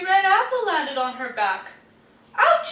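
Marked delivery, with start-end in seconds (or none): 2.4-2.7 much higher, louder little squeak. Acted. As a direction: yelp like a tiny hedgehog. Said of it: "she squeaked".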